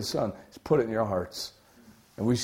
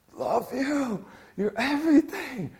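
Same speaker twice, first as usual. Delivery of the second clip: tender cry; 12 semitones higher, slower than usual. Acted. Voicing a believer's prayer.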